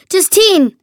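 a sudden summoning shout; calling out. A narrator's reading. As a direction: call across the room to get her attention. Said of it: "rief er".

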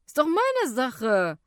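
defiant youth. A narrator's reading. Youth defends his music to a passenger, loudly defiant. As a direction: push back like a stubborn teenager.

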